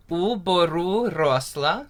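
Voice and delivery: slow, carefully spoken "Yahodka u boru rosla" with rolled r; teacher modelling a Ukrainian line; slow Ukrainian demo. Shown as a demonstration. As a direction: spell out "Yahodka u boru rosla" slowly for learners. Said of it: "Say that with me".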